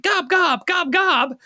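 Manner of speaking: high-pitched goblin chant; panicked gobloid. Acted. Captured gobloid jabbering at its captors, panicked. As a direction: yelp it high like a frantic little goblin.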